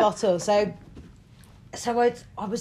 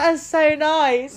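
gushing; 7 semitones higher, 4 dB louder, much slower than usual. Retold or imitated. Mum made tea, gushing.

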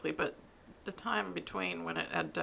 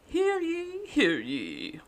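town crier; 10 semitones higher, 9 dB louder, much slower than usual. Acted. Mock medieval podcast opening.

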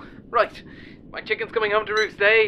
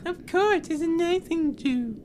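high-pitched singsong parroting; mock them in a squeaky put-on voice; childish, very high copycat taunt; mocking mimicry; 11 semitones higher than usual. Parrots her words back mockingly.

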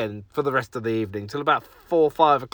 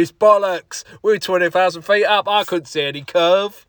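higher and louder mock voice of a doubter; imitating Justin; 6 semitones higher, 8 dB louder than usual. Co-host Justin on the plane, scoffing loud and high. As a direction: scoff like a stubborn flat-earther. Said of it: "That's how he talks now".

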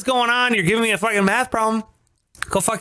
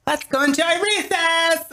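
mock singsong; 12 semitones higher than usual. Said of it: "see Pike walk out the door sprinting".

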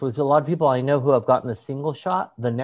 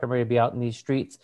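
ordinary talk, nothing marked

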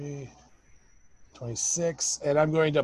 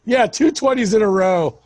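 excited; 6 semitones higher, 6 dB louder than usual. Real, not acted. Second crit in a row.

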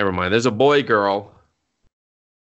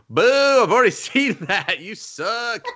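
mock heckling; 11 semitones higher, 4 dB louder than usual. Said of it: "And I was just like".

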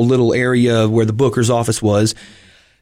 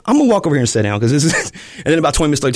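ordinary delivery

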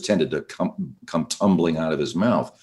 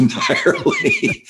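laughing; much slower than usual. Real, not acted. Laughing mid-explanation.

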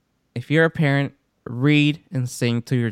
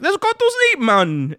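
high-pitched mock Jamaican accent; pretend Jamaican; 3 dB louder than usual. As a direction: call out bedtime like a playful Jamaican. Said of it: "pretending to be Jamaican and be like".

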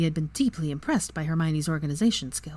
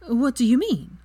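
higher-pitched, puzzled curiosity; curious question; 7 semitones higher, 4 dB louder than usual. A narrator's reading. Friend who never had a house elf, curious question. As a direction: ask it curiously, pitch lifted. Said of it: "she asked curiously".

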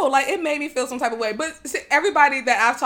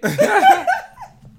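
high burst of laughter; 4 dB louder than usual. Real, not acted. Laughs at her own rant.

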